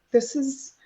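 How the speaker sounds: acting out an impatient retort; exasperated. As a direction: push back in mild, high-pitched disbelief. Acted exasperated reply to receptionist.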